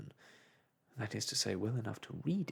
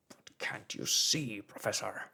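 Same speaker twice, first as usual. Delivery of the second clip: slow, louder exasperated plea; irascible protest; 3 semitones lower, 4 dB louder than usual. A narrator's reading. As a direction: press the point with mounting anger. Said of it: "said our irascible companion".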